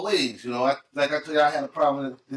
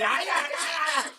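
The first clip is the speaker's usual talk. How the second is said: mock fight yelps; 12 semitones higher, much slower than usual. Biting in fight, laughing.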